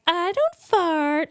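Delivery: mock prim denial, drawn out high; prissy denial. Imitating a prim friend who insists she never farts. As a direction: play the prudish friend denying it, slowly. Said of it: "women ... that are like".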